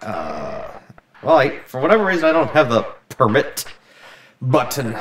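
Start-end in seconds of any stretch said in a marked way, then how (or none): 1.2-5.0 fast flustered. Real, not acted. Flustered burst.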